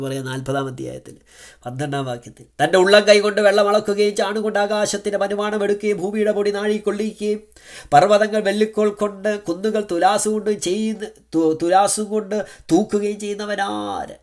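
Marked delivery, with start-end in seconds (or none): 2.6-14.2 high, ringing pulpit reading of scripture; a preacher proclaiming a verse to the flock; fervent scripture reading. Acted. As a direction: proclaim the verse with full fire.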